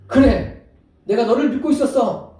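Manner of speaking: a loud, high, hearty yes; loud hearty approval. Acted. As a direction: cheer him on loudly. Imagined approving Jesus. (크고 높은 목소리로 흔쾌히 인정)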